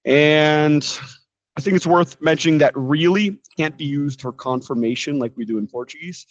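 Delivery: usual speaking voice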